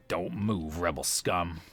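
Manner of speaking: low, soft, threatening guard voice; menacing stormtrooper. Acted. Trooper guarding the bound prisoner, threatening him, low and soft. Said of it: "kind of menacing".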